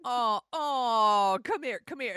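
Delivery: mild mock comfort for a crying friend. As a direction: soothe him like a friend who starts crying. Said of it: "she's like".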